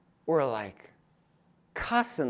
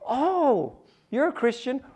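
high, bright excited exclamation; 11 semitones higher, 7 dB louder, slower than usual. Retold or imitated. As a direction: imitate a golfer's delighted surprise. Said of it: "he goes".

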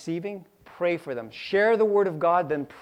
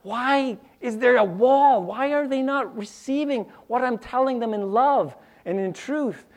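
higher, pleading why; frustrated asking; 7 semitones higher than usual. Retold or imitated. Preacher voicing his frustration with coworkers, higher pleading. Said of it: "I'm like".

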